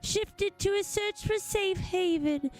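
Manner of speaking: solemn alien survivor. Acted. Voices alien's backstory.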